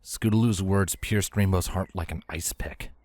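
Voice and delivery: ordinary talk, nothing marked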